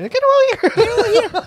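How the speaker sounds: high, slow, loud Scorpion yell; Scorpion catchphrase. Retold or imitated. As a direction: belt the catchphrase like the game voice. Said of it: "怎么到你这". (模仿蝎子的高声喊叫)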